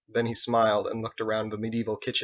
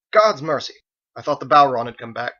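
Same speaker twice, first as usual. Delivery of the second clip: relieved exclamation; 2 semitones higher, 9 dB louder than usual. A narrator's reading. Armored man bursts out.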